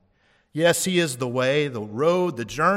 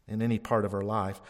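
ordinary talk

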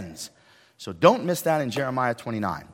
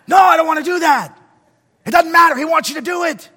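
retold shouting match; 12 dB louder than usual. Arguing with God, shouted back.

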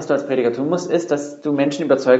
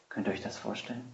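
soft, intimate rising question; hushed question; 3 semitones lower, 15 dB quieter, faster than usual. Real, not acted. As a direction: draw them in with a near-hush. Preacher invites listeners to imagine.

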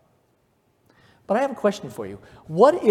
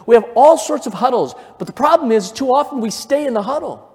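rising, louder sermon climax; preaching at full force; 7 semitones higher, 6 dB louder than usual. Acted. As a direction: drive the congregation to get out of the huddle.

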